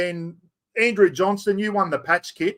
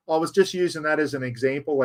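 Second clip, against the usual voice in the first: ordinary talk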